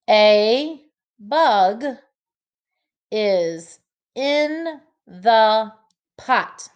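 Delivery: slow, each word separated; slow counting read for young learners. Shown as a demonstration. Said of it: "count how many words".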